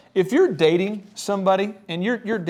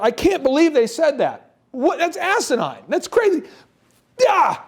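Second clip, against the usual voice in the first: high-pitched, loud incredulous rant; mock-furious venting; 9 semitones higher, 4 dB louder than usual. Venting alone driving home about the offer, mock-furious. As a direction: explode in disbelief, ending on a yell. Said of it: "I am mad".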